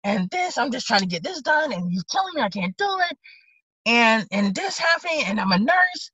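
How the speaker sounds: high-pitched, exasperated venting; imitating frustrated woman. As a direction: act out someone upset and fed up. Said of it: "getting a little loud. She's".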